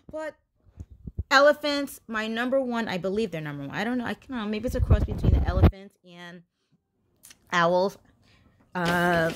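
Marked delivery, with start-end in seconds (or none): none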